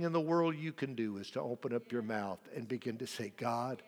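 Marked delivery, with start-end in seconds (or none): none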